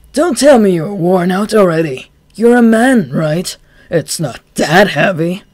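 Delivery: loud, high teasing scorn; mock the weakened gunman. Chuya mocking the gunman.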